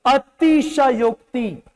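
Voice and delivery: अतिशयोक्ति very slow, each syllable drawn out; slow dictation of अतिशयोक्ति; spelling sounded out. Shown as a demonstration. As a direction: sound out अ-ति-श-योक्-ति in full. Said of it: "नहीं, शक्कर कषा पूरा।".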